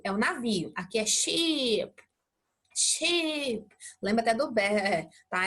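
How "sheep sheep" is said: long drawn-out ee in "sheep"; prolonged vowel. Shown as a demonstration. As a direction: hold the vowel of "sheep" slowly. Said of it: "É prolongado".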